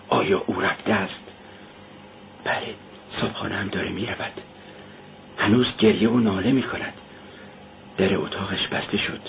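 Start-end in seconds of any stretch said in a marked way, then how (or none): none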